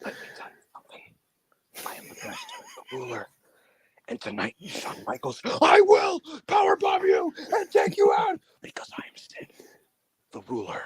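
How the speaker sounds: hoarse whispered shout, intense; whisper-scream a wrestling promo; whisper yelling. Retold or imitated. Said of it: "Whisper scream".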